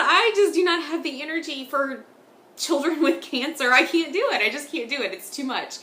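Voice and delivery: high, loud, laughing mock despair; exasperated laugh. Mom retelling her own reaction to a sad book, laughing high and loud. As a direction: throw up your hands, laughing, at children with cancer.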